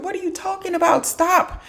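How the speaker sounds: very high, softened disbelief; squeaky mock protest. Teacher reacting to students calling themselves stupid, squeaky mock protest. Acted protest at the self-insult.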